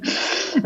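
short breathy nervous giggle; a quick, unsure little laugh. Real, not acted. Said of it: "sorry, it's just a nervous laugh".

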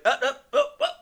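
high-pitched fake moans; short, loud, yelped moans; mock moaning. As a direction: imitate a squealing bottom in falsetto. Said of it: "he's talking dirty".

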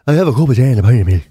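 low, fast, loud Mayo accent impression; full-on Mayo accent. Shown as a demonstration. Showing co-host how Mayo sounds, exaggerated Mayo accent. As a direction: rattle it off in thick Mayo. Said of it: "the full-on Mayo accent sounds like".

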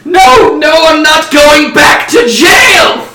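high, drawn-out panicked shout; desperate screamed refusal; yell. Acted. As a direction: yell it at full volume, very high. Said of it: "the guy yells".

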